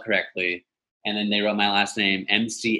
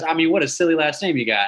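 lightly voiced sweet old lady, higher; Tony; 6 semitones higher than usual. Insurance agent teasing caller about surname, lightly imitated. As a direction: tease like a familiar older phone agent.

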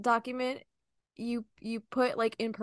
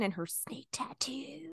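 whispering; 2 semitones higher, 11 dB quieter, slower than usual. Real, not acted. Gossipy aside about outfit.

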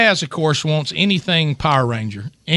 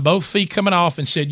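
usual speaking voice

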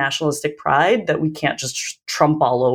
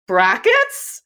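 tentative answer; 6 semitones higher than usual. Retold or imitated. Retells rep's unsure answer.

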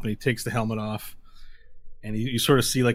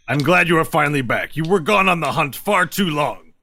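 higher, louder, formal lizard voice; lizard-folk sister; 6 semitones higher, 7 dB louder than usual. Acted. Lizard sister scolds brother back from a long hunt, raised formal voice. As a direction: greet a hunter home with raised voice. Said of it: "the other lizard folk goes".

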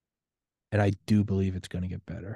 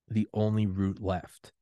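ordinary talk, nothing marked

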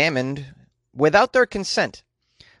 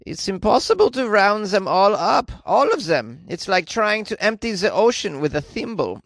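put-on high-pitched comic Flemish accent; 5 semitones higher than usual. Someone from the Flemish Institute despairing over crayfish, hammed-up Flemish accent. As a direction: quote it in an exaggerated Flemish accent. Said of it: "quote from someone from the Flemish Institute".

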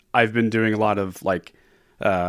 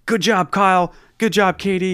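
mock coach praise; 7 semitones higher, 3 dB louder than usual. Coach praising kids, imitated.

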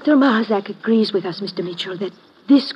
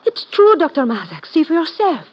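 alarmed insistence; 6 semitones higher than usual. Acted. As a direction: blurt out the discovery in alarm.

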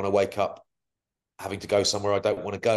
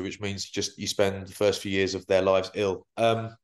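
ordinary talk

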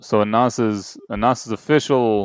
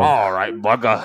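put-on Australian accent. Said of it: "Australian accent".

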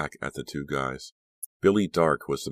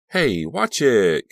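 louder, slow sharp protest; protesting shout; 7 semitones higher, 9 dB louder, much slower than usual. A narrator's reading. Caroline thrown by a swerve, protesting loudly to Joey. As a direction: snap at the reckless driver. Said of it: "Caroline protested to Joey".

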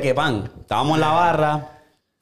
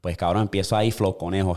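ordinary delivery

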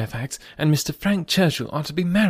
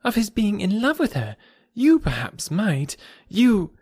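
high-pitched, earnest appeal; eager high character voice; crying out; 10 semitones higher than usual. A narrator's reading. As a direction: press the question in a lifted voice. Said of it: "cried Harriet".

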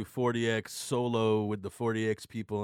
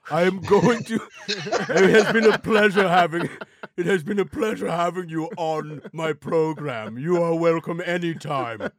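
put-on host voice; 3 semitones higher, 6 dB louder than usual. Hosting sign-off in put-on voice.

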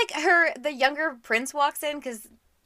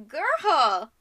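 mock-exasperated, stretched-out words; exasperated scolding; 8 semitones higher, 6 dB louder, much slower than usual. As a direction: scold a foolish heroine, dragging it out. Acted scolding of book heroine.

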